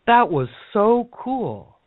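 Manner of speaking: high, slow, breathy-sounding amazement; awed delight. Retold or imitated. Woman after the imagined cauldron jump, slow high amazement. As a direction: stretch it out in wonder, pitched high.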